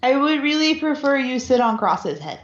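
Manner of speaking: usual speaking voice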